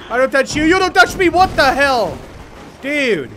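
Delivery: loud, high-pitched outrage; indignant protest. Real, not acted. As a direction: yell at the creature to back off. Protesting at an attacking game bat.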